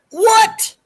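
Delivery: very high, loud, far-off voiced What; indignant officer. Harris turning on Bobcat, very high loud indignant What. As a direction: bark it back in outrage. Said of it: "he's like".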